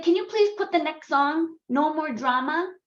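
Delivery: imitated child's voice, high and pleading; son's request. Son in the bathtub asks mom for next song, high imitation.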